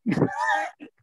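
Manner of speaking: sudden high-pitched burst of laughter; high shrieking laugh. Real, not acted. Laughs at the joke.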